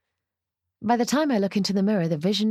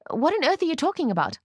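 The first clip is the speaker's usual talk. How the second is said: high, exasperated demand; frustrated; 9 semitones higher than usual. A narrator's reading. Bride snaps back at Ruth, frustrated. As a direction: burst out in bafflement, pitched high. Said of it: "I'm frustrated now".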